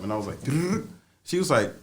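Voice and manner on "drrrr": high rolled drumming noise; footsteps noise. Retold or imitated. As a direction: imitate feet rattling down stairs. Imitates running downstairs.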